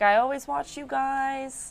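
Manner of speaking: put-on slow, old New York lady voice; a touch of New York accent. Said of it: "a thick New York accent".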